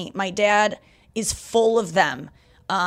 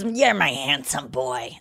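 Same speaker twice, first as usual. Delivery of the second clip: man doing a woman's voice; 6 semitones lower, slower than usual. Retold or imitated. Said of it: "That's impression of your mom that you do".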